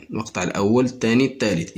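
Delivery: normal talking voice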